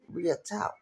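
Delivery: ordinary delivery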